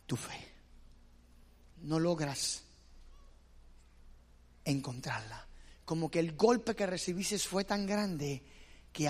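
preacher gently drops low and soft over lost faith; softened sermon. Acted. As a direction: sink the voice as the faith slips away. Preacher softens on painful loss.

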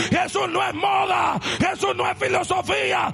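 preaching at full force. Acted. Pastor proclaiming to the congregation, preaching at full force. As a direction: preach it out to the whole church.

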